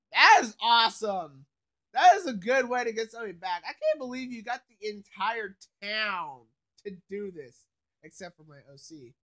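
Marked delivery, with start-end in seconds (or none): 0.1-6.4 excited. Real, not acted. Excited reaction to the prank.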